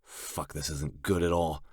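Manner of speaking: usual speaking voice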